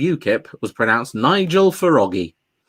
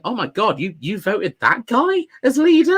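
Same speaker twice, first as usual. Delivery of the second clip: incredulous American; 6 semitones higher than usual. Retold or imitated. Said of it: "looking at us going".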